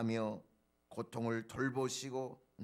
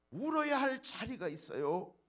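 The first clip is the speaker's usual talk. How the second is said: ordinary talk, nothing marked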